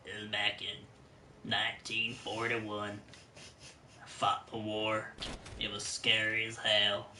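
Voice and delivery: low, soft, slow veteran voice; World War Two American. Shown as a demonstration. Re-doing a class project voice, slow low American war veteran. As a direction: recall the war slowly, down low and quiet. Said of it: "like, an American from World War Two time period".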